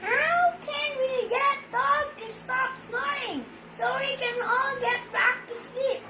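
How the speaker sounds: high, sweet little cat voice; cat's voice. Acted. Sleepy cat asking the other animals for help, high voice. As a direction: pitch way up and ask like a small sleepy cat. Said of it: "said cat".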